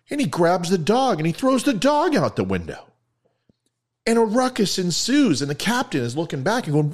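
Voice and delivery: high-pitched, escalating storytelling; animated joke telling. A narrator's reading. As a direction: tell it with mounting excitement. Excited joke narration.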